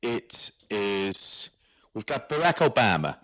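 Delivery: Barack Obama in a put-on Irish lilt, like The Guard; mock Irish accent. Co-host tosses the guest name in a mock Irish accent. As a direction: play it like a jokey Irish character. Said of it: "what accent was that then?".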